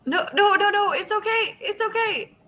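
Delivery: very high, pleading voice; frantic reassurance. Acted. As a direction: desperately try to calm someone panicking. Reassures the fleeing frightened woman.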